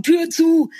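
loud call through the flat; shouting. Retold or imitated. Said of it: "brüllt es dann immer durch die Wohnung".

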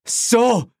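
high, indignant cry; called out. A narrator's reading. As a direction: call it up at him, pitched high. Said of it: "rief Gerald Cordial". (empört gerufen)

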